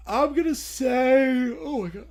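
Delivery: loud, drawn-out words through a yawn; real yawn stretching the words. Real, not acted. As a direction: let a big yawn swallow the words. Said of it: "I don't know why I'm yawning so much".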